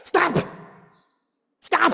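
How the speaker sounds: sharp high stop, retold; angry shout. Dad at his daughter, a sharp high stop. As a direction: snap stop at the child. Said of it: "the anger was there".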